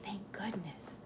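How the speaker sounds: whispered relief; relieved whisper. A narrator's reading. Narrator whispers relief that Crusoe stays hidden. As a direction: breathe it out in a whisper.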